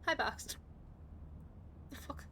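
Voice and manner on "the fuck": hiccup cutting the words. Real, not acted. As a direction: talk through a hiccup. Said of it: "I'm hiccuping because of the eggs".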